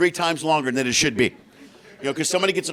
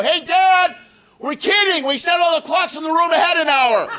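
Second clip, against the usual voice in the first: high, loud calling out; mock daughter shouting from afar; yelling daughter; 11 semitones higher, 5 dB louder than usual. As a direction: call down the hallway teasing.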